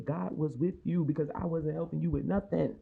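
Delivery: ordinary talk, nothing marked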